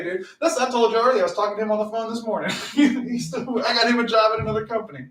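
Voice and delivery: usual speaking voice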